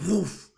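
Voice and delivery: loud high woof as a shove; pushing woof. Acted. Universe pushing you off balance, loud high woof. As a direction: bark out a shove sound. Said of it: "the universe goes".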